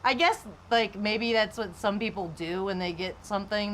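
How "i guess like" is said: ordinary talk, nothing marked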